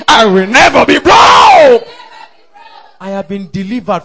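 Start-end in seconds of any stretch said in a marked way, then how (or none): 0.1-1.9 slow shout swelling to a huge cry; drawn-out shout. Shown as a demonstration. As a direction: stretch it out and blast the last word. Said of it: "If you cannot shout it, dance, sing. Say".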